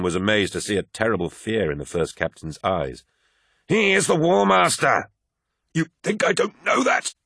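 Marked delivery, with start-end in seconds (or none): 3.7-5.1 very high, impassioned roar; furious shout. A narrator's reading. First Captain choking apothecary over the War Master, furious shout. 5.7-7.1 high, strangled protest; gasped. A narrator's reading. Apothecary being choked by First Captain, gasped. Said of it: "gasped Vaden".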